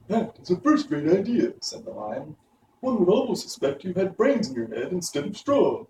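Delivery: high, lightly voiced lion, teasing; admiring lion. A narrator's reading. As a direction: praise the idea with a friendly jab. Lion praises Scarecrow.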